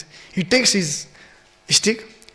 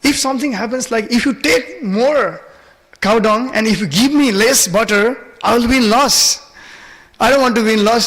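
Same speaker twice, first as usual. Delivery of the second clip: insistent haggling in a raised voice; protesting child Krishna; 4 semitones higher, 3 dB louder than usual. Retold or imitated. Little Krishna fretting over his butter, insistent. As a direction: protest the deal, pitched up and pressing.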